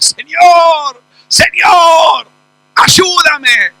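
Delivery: loud high desperate pleading; mother shouting after Jesus on the road; crying out. Acted. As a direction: shout after him, begging.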